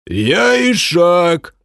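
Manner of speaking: high, slow, loud cry; piercing shout. Acted. Porter half-orc yelling his trade to crowd, piercing. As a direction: yell it out piercingly. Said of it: "пронзительно выкрикнул".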